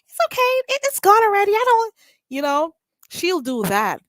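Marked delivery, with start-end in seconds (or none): 0.1-1.9 high, fast, sugary mock forgiveness; mocking a fake forgiving voice. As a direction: put on a falsely sweet, breezy forgiving voice. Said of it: "She's willing to".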